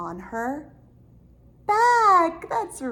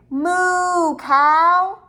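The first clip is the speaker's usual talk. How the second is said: called out moo; 7 semitones higher, 10 dB louder than usual. Shown as a demonstration. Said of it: "Let's say".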